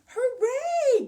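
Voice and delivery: high, loud hooray; bright cheer for the jumpers. Real, not acted. Celebrating ten jumps.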